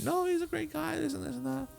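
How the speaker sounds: mock innocent wife. Wife feigns innocence under questioning.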